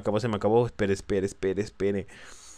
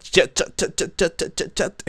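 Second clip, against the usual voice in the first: echo effect; 9 semitones higher, 4 dB louder, slower than usual. Pretend. Mimicking a headshot echo.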